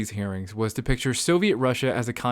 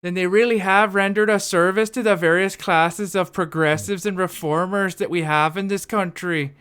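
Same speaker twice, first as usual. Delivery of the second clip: a higher Minnesota accent sliding toward Irish; 4 semitones higher than usual. Shown as a demonstration. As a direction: read it like a Minnesotan senator. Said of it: "kinda drifting into ... an Irish accent".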